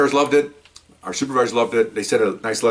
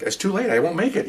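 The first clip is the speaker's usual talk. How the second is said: playing innocent; 10 semitones higher than usual. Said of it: "I said".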